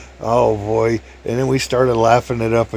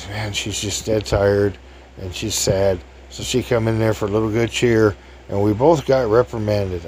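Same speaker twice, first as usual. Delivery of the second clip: usual speaking voice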